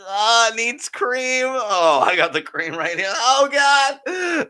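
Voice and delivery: slow, high, hammy innuendo; suggestive voice. Acted. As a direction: play it up, very slow. Hammed-up suggestive bit.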